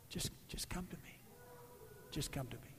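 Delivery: a gentle whispered invitation to come home; tender whisper. Acted. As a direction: draw them close with a hushed plea. Jesus voiced, tender invitation.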